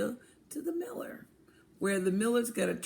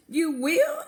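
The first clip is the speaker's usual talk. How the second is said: surprised; 8 semitones higher, 7 dB louder than usual. Acted. Hen surprised at sudden helpers.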